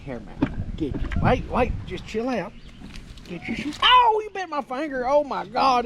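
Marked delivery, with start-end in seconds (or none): 3.8-5.8 high, loud yelp of surprise; real startled cry after a bite; bitten surprise. Real, not acted. Pig bites his finger.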